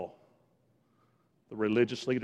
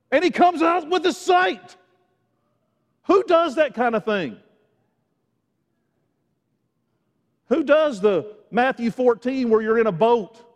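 raised, driving preacher's voice; impassioned preaching; 7 semitones higher, 8 dB louder than usual. Real, not acted. Preacher marvels to congregation at what Jesus does, impassioned. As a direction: build the amazement with punchy emphasis.

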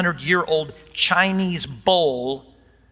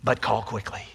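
soft, lowered ad tag line; infomercial voice; 4 semitones lower, 6 dB quieter than usual. Pretend. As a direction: toss off the sales urge quietly. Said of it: "sound like an infomercial".

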